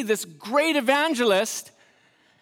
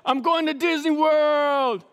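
cheery athlete; 5 semitones higher than usual. Retold or imitated. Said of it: "Magic's like".

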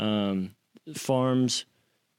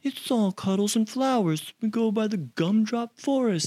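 much higher, sing-song mock sweetness; mocking sappy voice; 10 semitones higher than usual. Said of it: "You can't just be like".

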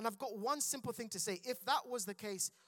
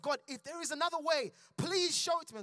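pleading Jesus; 7 semitones higher, 4 dB louder, faster than usual. Acted. Preacher voices Jesus pleading.

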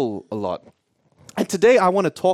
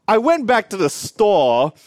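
ordinary delivery

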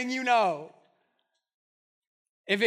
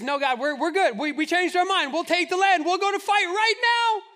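rising, louder, panicked promises; frantic pleading; 8 semitones higher, 5 dB louder than usual. Retold or imitated. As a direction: beg God in a rush, building higher. Israelites pleading, voiced.

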